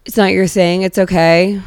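normal talking voice

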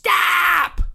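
loud, high, drawn-out yelled command; retold shout. Pedestrian dad ordering a parked driver to halt, shouting. Acting out the man yelling stop.

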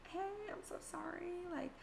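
texting clients a fake sick excuse, sweetly. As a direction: put on a sweet apologetic lie. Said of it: "make up this complete lie".

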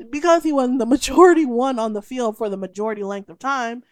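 loud, laughing rant; laughing through it. Real, not acted. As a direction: push through a laugh while ranting. Laughs while ranting about Scotty.

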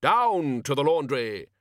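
loud, slow, grand command; booming wizard. A narrator's reading. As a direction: declare it like a general. Wizard chief commands loudly.

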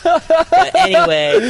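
slow, high laugh-filled words; trying to move on while still laughing. Real, not acted. Still laughing after the joke.

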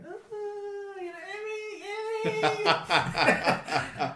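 very high sing-song mock girl calling his name; girly voice. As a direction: squeal it like a tipsy clubgoer greeting him. Mimicking girls at the club.

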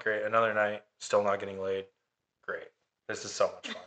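dry, sarcastic grumble. Retold or imitated. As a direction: roll your eyes, mutter it flatly. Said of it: "I'm a little irritated".

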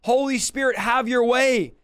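impassioned prayer rising in pitch; fervent prayer. Real, not acted. Preacher praying for the Holy Spirit, fervent and rising. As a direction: plead with God, building phrase by phrase.

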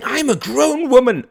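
high, very loud indignant cry; shouted, shuddering indignation. Acted. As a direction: protest at full volume. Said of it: "cried Mary, shuddering".

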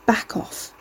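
hushed, breathy warning; muttered. Acted.

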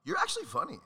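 squeaky high-pitched mock praise; mock surprised compliment. Mock amazed praise to a comedian. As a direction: jump way up high, like a stunned fan. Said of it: "people are like".

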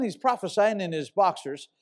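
ordinary delivery

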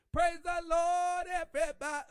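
shouted praise call. Shown as a demonstration. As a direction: call the congregation in. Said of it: "You got to say it like that".

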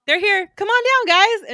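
high, loud game-show come on down; hammed-up cheerful introduction; cheery announcement. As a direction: welcome them in like a host. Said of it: "Telegonus is like".

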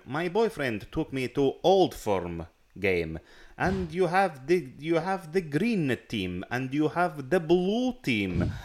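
hammed-up fake Russian accent, slowly. Mimicking the comic's Old Firm bit in mock Russian accent. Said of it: "stereotypical Russian accent".

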